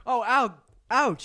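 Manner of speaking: yelp of pain. Real, not acted. Just got punched by a friend.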